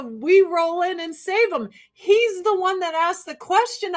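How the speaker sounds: usual speaking voice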